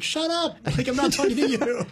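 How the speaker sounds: high mock snap at the phone. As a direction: bark it back at the nagging assistant, half joking. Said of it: "I'm like".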